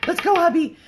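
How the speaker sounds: high, loud, eager mock begging; eager mock plea. To her husband after the show, a high, loud, eager mock plea. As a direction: squeal it like you can't wait. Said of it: "and then go".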